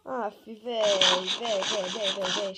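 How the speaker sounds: loud frantic repeated cries; panicked yelling at the game; panicking gamer. Real, not acted. Gamer panicking mid-fight.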